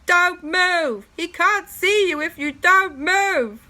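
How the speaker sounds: loud, high urgent shout. Acted. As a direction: yell down a warning. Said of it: "Owen shouted down".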